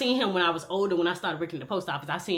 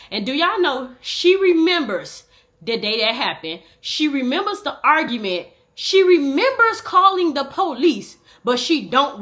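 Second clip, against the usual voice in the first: indignant; 6 semitones higher, 6 dB louder, slower than usual. Real, not acted. Emphatic disbelief at grandma.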